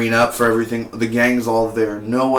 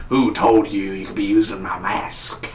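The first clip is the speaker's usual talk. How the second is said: menacing villain voice; 2 semitones lower than usual. Retold or imitated. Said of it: "he's like".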